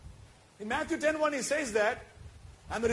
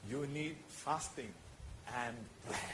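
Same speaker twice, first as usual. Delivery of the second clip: hushed preaching; 8 semitones lower, 12 dB quieter than usual. Real, not acted. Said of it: "preached hushed ending".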